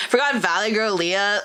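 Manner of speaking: ordinary delivery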